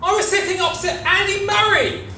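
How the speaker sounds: high, loud burst of excitement; excited reveal. Real, not acted. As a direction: announce it like big news. Big reveal of Andy Murray.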